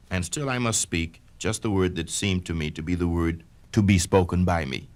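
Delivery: ordinary delivery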